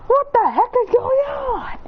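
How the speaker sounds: high, slow wailing shout; crying shout. Acted. Baby wailing at the silly names. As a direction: wail it out like an upset kid.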